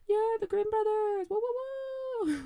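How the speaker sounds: high ghostly wooing; spooky ghost woo. Pretend. Spooky ghost noises for Grimm.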